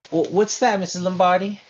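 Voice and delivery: high, loud concerned question; high alarmed dance teacher. Acted. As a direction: gasp it out in falsetto concern. McAllister asks Lombardi.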